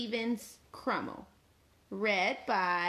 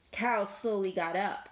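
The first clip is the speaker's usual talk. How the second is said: ordinary delivery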